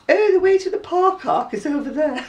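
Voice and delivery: lightly put-on posh English voice, high. The friend giving directions to a gent, in mock posh English. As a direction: say "park arc" in a mild posh accent. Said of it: "'Cause I was putting on my posh voice".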